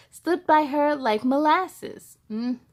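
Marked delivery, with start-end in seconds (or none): none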